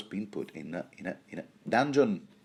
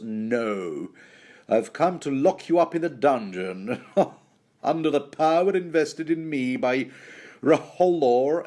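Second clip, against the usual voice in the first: louder, higher hammed-up official; villainous Axel; 5 semitones higher, 4 dB louder than usual. Axel telling Davos he's for the dungeon, pompous villain. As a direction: pronounce it like a pompous pantomime villain. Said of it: "disappointingly, Axel goes".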